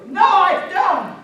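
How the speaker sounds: high, drawn-out shouted denial; loud arguing. Acted. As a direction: yell back in a hammed-up quarrel. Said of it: "they started getting loud".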